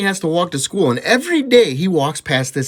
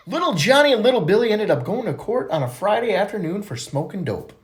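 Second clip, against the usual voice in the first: ordinary talk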